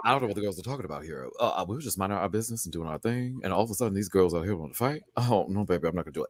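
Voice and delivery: lightly voiced Relly, low and soft; Relly impression. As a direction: slip into Relly's confessional voice. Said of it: "you got his voice so fucking down packed".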